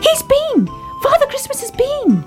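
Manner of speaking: very high thrilled little voice; baby bear bursting with delight; excited cry. Acted. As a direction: shout with Christmas joy. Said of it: "cried Baby Ted".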